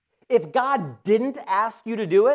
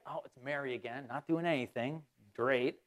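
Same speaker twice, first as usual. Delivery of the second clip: softer, lower, bitter sarcasm; sarcastic grumble; 9 semitones lower, 13 dB quieter than usual. Acted. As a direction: mutter the complaint sourly under the breath. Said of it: "she's probably like".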